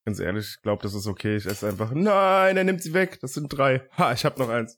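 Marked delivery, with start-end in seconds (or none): 1.9-4.8 mock outrage. Real, not acted. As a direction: cry out in pretend outrage. Mock outrage over snacks.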